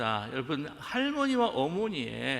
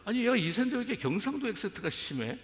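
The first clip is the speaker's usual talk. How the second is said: higher, fast, half-mock disbelief; surprised; 8 semitones higher, faster than usual. Pastor recalls thinking aloud, surprised by accent. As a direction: blurt it out, amused and amazed. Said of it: "깜짝 놀라요".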